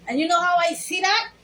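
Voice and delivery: ordinary talk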